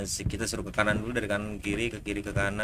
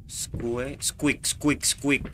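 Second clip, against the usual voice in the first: imitated squeak; 3 semitones higher, 3 dB quieter, much slower than usual. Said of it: "Squeak ini semacam apa ya?".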